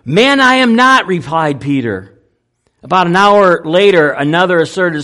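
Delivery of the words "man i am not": denying it, higher and louder; heated denial. Acted. Peter snapping back at the man who accuses him. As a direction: push the accusation away hard.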